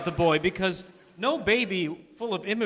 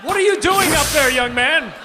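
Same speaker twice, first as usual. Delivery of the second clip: calling upstairs; 5 semitones higher, 5 dB louder than usual. Acted.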